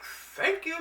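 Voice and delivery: high, relieved thanks; finally someone listened; retold relief. Patient thanking God for referral, high relieved cry.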